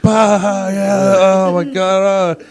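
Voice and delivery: high, slow laughing cry; imitating hysterical laughter. Ten mourners around him bursting out laughing, mock laughter. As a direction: ham up a crowd cracking up. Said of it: "started hysterically laughing".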